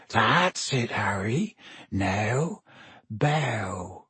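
hushed coaxing, low and soft; Hagrid. A narrator's reading. Hagrid coaxes his pupil to bow, hushed. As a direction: guide gently, pausing before the command.

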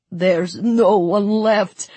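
slow, anguished wail; sobbing. A narrator's reading. Sergei holding dead Marie in his arms, sobbing. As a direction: draw it out in tearful despair. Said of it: "he sobbed".

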